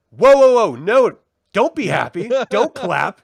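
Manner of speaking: loud, high-pitched mock scolding; mock judge outburst. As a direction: play the outraged judge shutting down the cheering. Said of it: "and Paul is like".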